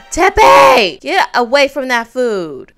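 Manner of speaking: sudden high, loud yell; yelling at sim. Real, not acted. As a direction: shout him off the food. Yelling at sim near food.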